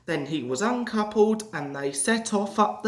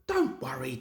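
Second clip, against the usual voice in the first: soft whispered reassurance; 5 semitones lower, 5 dB quieter than usual. A narrator's reading. Driver quietly reassuring Toby, whispering. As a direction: lean in and whisper it.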